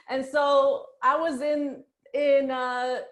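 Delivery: a high laugh spilling into the words. Real, not acted. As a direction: laugh it off, slowly. Laughing at own drama.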